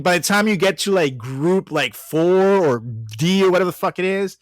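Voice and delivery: usual speaking voice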